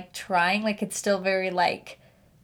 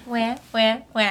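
mechanical crying doll. Pretend. Mock baby crying at the intro.

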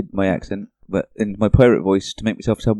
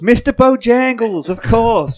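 sing-song; 11 semitones higher, much slower than usual. Acted. Joking name recall.

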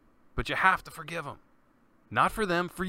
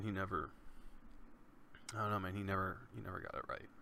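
trailing off into near silence, grieving; barely holding it together over his brother; choked-up quiet; 4 semitones lower, 14 dB quieter, faster than usual. Real, not acted. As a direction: grief pulls the voice down low and quiet.

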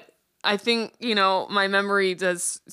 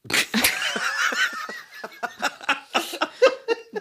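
long burst of high laughter; helpless, drawn-out laughing fit. Real, not acted. Friends reacting to the two dads remark, long laughing fit.

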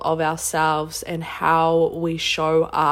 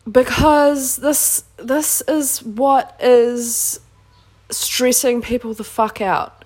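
frustrated; 5 semitones higher than usual. Real, not acted. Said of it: "a little bit of frustration there".

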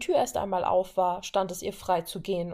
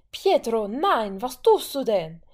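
higher, louder protest; protest cries; 4 semitones higher, 9 dB louder, slower than usual. Acted. Wanda protesting as her brother carries her off, crying out. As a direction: cry out against her brother. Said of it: "Wandas Protestschreie".

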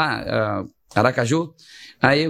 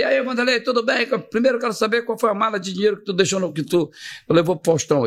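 mocking radio host; 6 semitones higher than usual. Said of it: "Aí o cara já veio".

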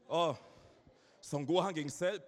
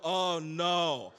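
long, drawn-out slow-motion cry; mock horror in slow motion; 5 dB louder than usual. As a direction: stretch it out loud like a slowed-down film. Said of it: "so in Zeitlupe".

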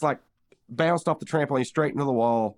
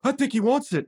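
higher, knowing deadpan quip; Mordecai quip; 10 semitones higher than usual. Retold or imitated. Mordecai commenting on Rigby, high sly quip. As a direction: say it like a sly aside, pitched up. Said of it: "Mordecai's like".

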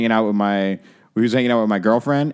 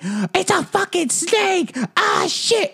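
very high mock shriek, words stretched out; squealed panic, put on for the story; drunk girl screaming; much slower than usual. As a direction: imitate a drunk girl freaking out in falsetto. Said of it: "the drunk girl was just like".

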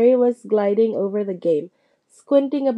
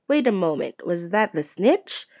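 ordinary delivery

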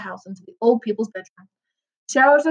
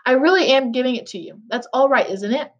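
usual speaking voice

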